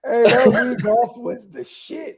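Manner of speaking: high, slow laughing words; high, slow laughing nostalgia. Real, not acted. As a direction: laugh it out while talking. Laughing excitedly about Wii.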